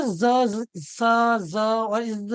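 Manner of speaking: ज, स, इज each held out as a buzz or hiss; isolated ending sounds. Shown as a demonstration. Teacher listing plural endings, ज, स, इज sounded out slowly. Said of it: "ये sound हमको कैसे पता चला".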